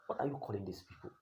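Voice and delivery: ordinary delivery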